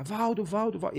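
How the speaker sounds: high-pitched but held-back mock yell; retold cry for help. Teller reenacts shouting for his friend downstairs, mock yell. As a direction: call out a name urgently without real volume. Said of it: "eu comecei a gritar".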